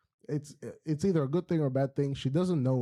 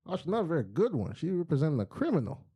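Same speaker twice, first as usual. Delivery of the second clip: normal talking voice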